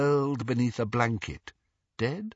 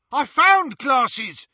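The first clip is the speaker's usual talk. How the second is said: high, loud henchman shout; excited yelp; 8 dB louder, slower than usual. A narrator's reading. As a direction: yelp out a find to the boss. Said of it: "yelped one of the Snatchers".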